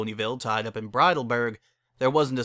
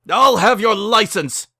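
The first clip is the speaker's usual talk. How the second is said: loud, higher, slow furious shriek; shrieked threat; 9 semitones higher, 10 dB louder, slower than usual. Acted. The father threatens Barry after the wedding, shrieking. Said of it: "shrieked the intruding pony".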